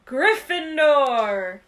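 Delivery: slow, loud shout; hat shouting. A narrator's reading. The hat announcing Harry's house to the hall, slow loud shout. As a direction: call it out to the whole hall. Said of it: "heard the hat shout the last word".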